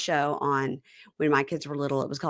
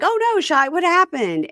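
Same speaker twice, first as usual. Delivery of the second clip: put-on high, louder fussing over a child; mock worried parents; 12 semitones higher, 5 dB louder, slower than usual. Parents fussing over the bitten child, high mock alarm. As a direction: coo with alarm, much higher. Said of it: "And they're like".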